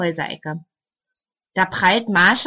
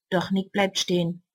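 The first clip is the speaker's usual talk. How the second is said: usual speaking voice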